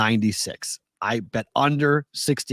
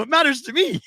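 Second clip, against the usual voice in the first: high, eager protest; childlike insistence; 13 semitones higher than usual. Retold or imitated. To his wife, defending the hockey app, high and eager. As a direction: plead your case like a kid. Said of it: "I go".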